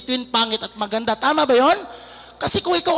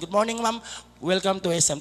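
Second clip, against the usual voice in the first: soft, low greeting; courteous mall guard; 8 semitones lower, 7 dB quieter than usual. Acted. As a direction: lower and soften it into a polite welcome. Guard courteously greets a pretty shopper.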